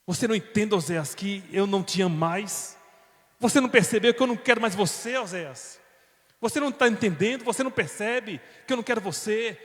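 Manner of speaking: loud, high cutting shout; harsh rejection. Acted. Wife shouting at her husband that she is leaving, loud and cold. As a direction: throw the words at him without mercy. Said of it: "wife leaving husband, shouting".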